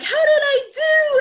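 put-on very high, loud, slow child voice; squeaky excited kid voice; eager kid. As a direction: ask it like a bright little girl. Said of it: "she was like".

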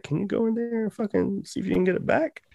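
high, softened pleading; imitated pleading. Worker asking manager to retrieve his vape, imitated high pleading.

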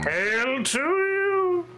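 slow, high, lofty hail; grand greeting. Acted. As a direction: proclaim it like a herald. Grand, drawn-out outro greeting.

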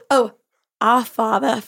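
halting attempt at Australian vowels; Australian accent. Shown as a demonstration. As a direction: begin a prayer in mock Australian. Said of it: "Here is my Australian accent".

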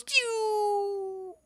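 long high whistling "doo"; imitated arrow flight.

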